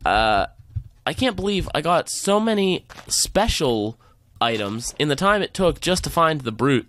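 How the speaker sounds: ordinary talk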